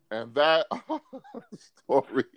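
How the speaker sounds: laughing through the words; real suppressed laughter. Real, not acted. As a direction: crack up while trying to tell it. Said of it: "can't even talk about it without laughing".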